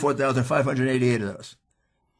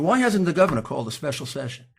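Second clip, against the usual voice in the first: ordinary talk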